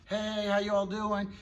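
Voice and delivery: high, loud gushing hello to the dog; excited greeting. Retold or imitated. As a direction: gush over the dog like coming home. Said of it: "they see their dog for the first time".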